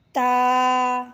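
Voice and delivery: crisp light ta for the class; letter ta. Shown as a demonstration. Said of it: "سب سے پہلے کیا ہے".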